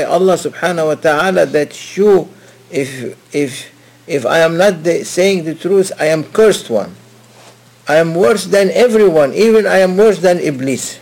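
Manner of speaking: loud, fervent self-condemning oath; a murid swearing by Allah that he is worst; confident oath. Retold or imitated. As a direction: swear it out with full conviction. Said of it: "With confidence".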